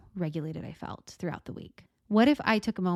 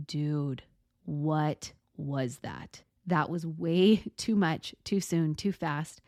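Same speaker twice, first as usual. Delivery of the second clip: lower, slow, a hint of a tired complaint; worn-out body; 3 semitones lower, much slower than usual. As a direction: grumble like an exhausted buddy. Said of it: "your body's gonna be like".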